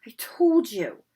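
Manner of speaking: whispered, out of breath; breathless whisper. Acted. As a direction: gasp it on spent air. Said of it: "Hermione gasped".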